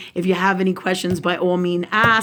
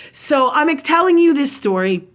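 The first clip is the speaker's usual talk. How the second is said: ordinary talk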